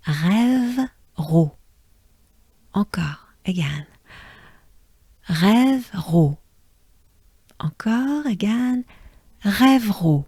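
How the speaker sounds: Rêve, ro with breathy French R; French Rêveraud. Shown as a demonstration. Teacher building Rêveraud part by part. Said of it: "So as a whole".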